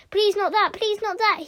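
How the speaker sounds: high-pitched pleading; anxious, high repeated plea; begging. Acted. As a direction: beg desperately to be spared. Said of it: "he begged".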